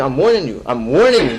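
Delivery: loud, firm divine warning, repeated; stern warning. Acted. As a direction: bear down hard on each warning. God's warning voiced as quote.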